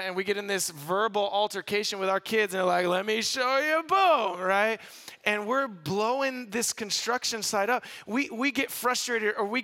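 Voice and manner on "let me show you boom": rising to a loud boom; a hammed-up boom; mock explosive boom. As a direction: play the exploding parent, big on boom. Parent blowing it up.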